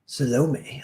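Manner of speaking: Salome said suh-LO-may, stressing LO; wrong Salome. Retold or imitated. Actress flubs another take, Salome as suh-LO-may. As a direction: hit the middle syllable of Salome. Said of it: "she's making me say it wrong".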